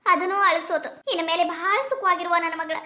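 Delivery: ordinary delivery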